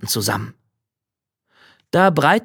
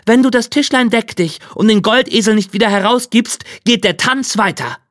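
raised, insistent threat; threatening ultimatum; 7 semitones higher than usual. Acted. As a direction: lay down an ultimatum in a higher voice. Drechsler droht dem Wirt. (drohend, mit erhobener Stimme)

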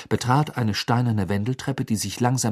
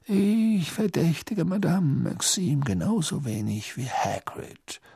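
calm; 7 semitones higher, slower than usual. Acted. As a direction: stay perfectly composed. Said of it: "sagte Dumbledore weiterhin gelassen".

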